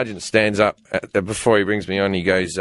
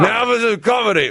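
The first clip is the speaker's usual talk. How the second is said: booming staff marshal; 10 semitones higher than usual. Retold or imitated. Officer introducing the comedian, mock booming voice. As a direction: boom it out like a parade officer. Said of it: "big booming staff marshal voice".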